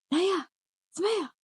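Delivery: imitated mother calling her name; mom calling out. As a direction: call the daughter's name like mum.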